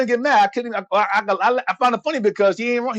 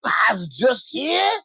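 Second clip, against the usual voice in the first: minstrel act; 3 semitones higher than usual. Acted. Playing a subservient minstrel figure.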